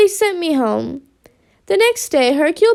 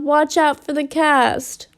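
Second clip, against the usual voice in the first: ordinary delivery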